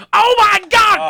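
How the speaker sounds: high shouted announcer call; JR impression. Co-host doing the commentator's famous call, shouting. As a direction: bellow it like a stunned ringside commentator. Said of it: "as good old J R would say".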